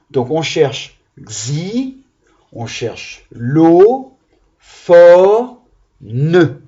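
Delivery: slow, each syllable split apart, "fo" punched loud. Shown as a demonstration. Teacher to children, splitting xylophone into syllables. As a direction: spell out xy, lo, fo, ne in beats. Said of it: "Quatre syllabes".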